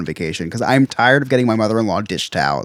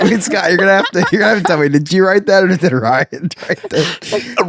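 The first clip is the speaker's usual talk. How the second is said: laughing; 7 semitones higher than usual. Real, not acted. Asking while laughing.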